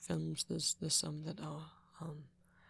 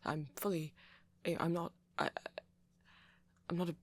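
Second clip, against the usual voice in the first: usual speaking voice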